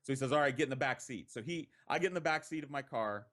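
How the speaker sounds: usual speaking voice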